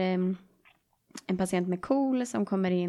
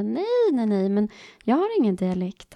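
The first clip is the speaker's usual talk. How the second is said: high, loud mock-indignant denial; retold protest; 3 semitones higher, 6 dB louder than usual. Student denying her dialect to a classmate, mock-indignant protest.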